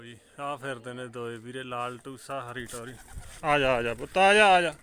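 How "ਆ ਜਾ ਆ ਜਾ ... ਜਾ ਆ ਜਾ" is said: calling out. Real, not acted. Calling pigeon back to him.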